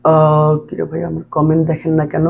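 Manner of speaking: low, subdued mock complaint; mock hurt. Teacher reads a student's ignored-comment complaint, mildly mock-sad. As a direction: read it out low, lightly playing hurt. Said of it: "কষ্টের emoji".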